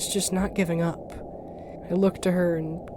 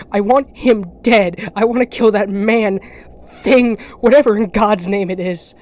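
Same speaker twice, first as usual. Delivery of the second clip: louder, seething rage; furious; 8 dB louder than usual. Acted. Narrator raging about killing the ferryman, furious. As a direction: spit out the threat in anger.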